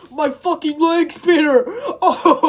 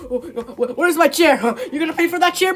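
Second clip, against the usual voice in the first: ordinary talk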